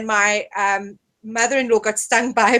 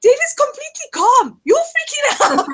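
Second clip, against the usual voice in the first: mocking retort; 13 semitones higher than usual. [name] teasing his panicking wife, high mock voice. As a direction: toss it back at her, squeaky and amused. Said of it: "And [name] just".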